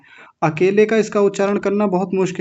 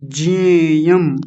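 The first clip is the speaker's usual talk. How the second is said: कथम न ज्ञेयम very slowly; slow Sanskrit drill; slow recitation; much slower than usual. Shown as a demonstration. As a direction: draw out each word for learners. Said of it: "ज्ञेयम नहीं".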